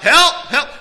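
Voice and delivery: high, loud mock cry for help; calling out for help. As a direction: call out as if from the water. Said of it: "waiting for her to call out".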